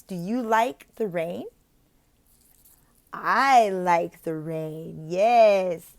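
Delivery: slow, clear question and answer; slow modeled chat. Shown as a demonstration. Tutor models slow rainforest chat with a child. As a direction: model the exchange slowly. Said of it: "Very simple".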